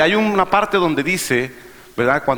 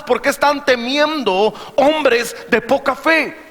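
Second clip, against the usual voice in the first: impassioned sermon, building higher to drawn-out questions; full-force preaching; 6 semitones higher than usual. Real, not acted. Preacher challenging fearful believers, impassioned preaching. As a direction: drive each point home to the congregation.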